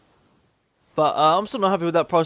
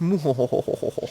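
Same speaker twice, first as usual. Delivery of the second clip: hammy villain laugh. Pretend. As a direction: ham up a sinister laugh. Mocking his own poshness.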